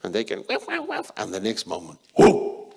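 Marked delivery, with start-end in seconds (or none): 0.5-1.1 high, rapid small-dog yaps; squeaky little dog barking; yapping little dog. Retold or imitated. Little dogs yapping again. 2.1-2.7 loud breathy startle; a sudden loud yelp of surprise; startled whoa. Retold or imitated. Big dog scares little dogs.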